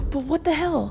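whispered what the hell; breathy unvoiced aside. Real, not acted. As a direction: hush it in disbelief. Whispered disbelief.

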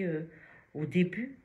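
ordinary delivery

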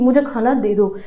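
usual speaking voice

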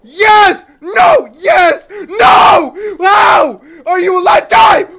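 loud, high yells swinging from hope to despair; frantic screaming at the boss; real screaming. Real, not acted. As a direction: yell every word at the screen. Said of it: "I'm just in here fucking screaming".

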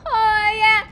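high, loud "Oh, yeah" meme; meme chorus voice. Retold or imitated. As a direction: call it out like the meme. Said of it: "you just hear this chorus of".

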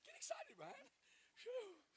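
squealing very high cheer; excited cheer. Real, not acted. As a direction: hype him up with a sudden high squeal. Hyping up a boy in church.

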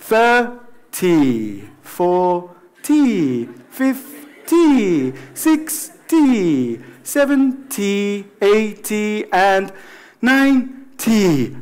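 slow, drawn-out, relaxed count. Shown as a demonstration. Teacher drilling the bigger numbers, relaxed and slow. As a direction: let each "-ty" stretch out lazily. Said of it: "relajaros, por favor".

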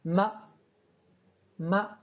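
"ma" sans aucun ton, posé sans monter ni descendre; ton neutre. Shown as a demonstration. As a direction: dire "ma" au ton neutre, court et relâché. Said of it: "il n'y a aucun ton dessus". (« ma » prononcé au ton neutre)

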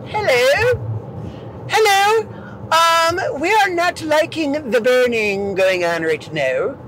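high put-on voice for talking muscles; slow, comically polite mock protest; complaining quads. Burning quads complaining to their owner, high mock-polite voice. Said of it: "your quads are like".

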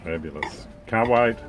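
ordinary talk

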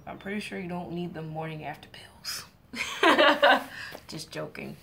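cheeky whisper to the audience; conspiratorial joking whisper; whispered aside. Real, not acted. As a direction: lean in and whisper the naughty joke. Cheeky joke aside to Psyche.

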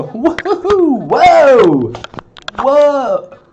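high, loud whoops and whoas; wild whooping. Real, not acted. Streamer shows chat something crazier, whooping excitedly. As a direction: holler as if it just got crazier. Reacting wildly as things get crazier.

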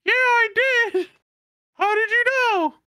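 squeaky pretend Luna answering; mock Luna voice. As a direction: play a man faking a little girl. Monkey man playing Luna.

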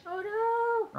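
mock dismay. Real, not acted. GM hearing the chicken burnt, squealing mock horror. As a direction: ham up the horror.

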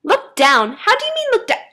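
high, startled questioning; baffled questioning. A narrator's reading. Girl puzzled by the voice, high baffled questioning. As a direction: echo it back incredulously.

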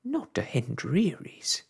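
a mother's cry, "Not to Hendreary's" lifted high; cried out. A narrator's reading. Wife refusing to go to Hendreary's, high cry. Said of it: "cried Homily".

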